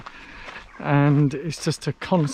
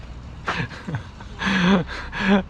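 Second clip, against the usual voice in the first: laughing; 2 semitones higher than usual. Real, not acted. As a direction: chuckle at your own slip. Cracks up at own slip.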